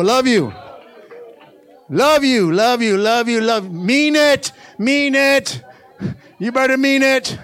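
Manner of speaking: high, loud, insistent repeats; rally the congregation with punchy commands; full-force exhortation. Real, not acted. Preacher urges church to say love you sincerely, insistent.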